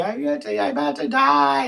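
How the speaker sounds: high, strained last-words voice; a hint of a dying heroine; dying woman. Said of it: "and she's".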